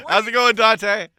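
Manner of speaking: calling out. Real, not acted. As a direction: call across the room. Calling to DJ in back.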